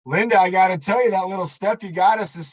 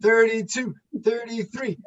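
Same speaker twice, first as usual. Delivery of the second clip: doing jumping jacks; 6 semitones higher than usual. Real, not acted. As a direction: call the count while jumping. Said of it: "Ben doing jumping jacks".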